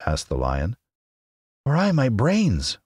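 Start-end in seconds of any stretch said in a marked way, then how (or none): 1.6-2.8 slow, high-pitched lament; high, drawn-out scarecrow whine; wailing. Acted. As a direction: wail it out, long and high. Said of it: "wailed the Scarecrow".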